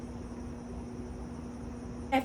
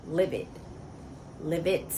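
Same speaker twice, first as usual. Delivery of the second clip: quiet livid; 3 semitones lower, 9 dB quieter than usual. Real, not acted. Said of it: "I am livid".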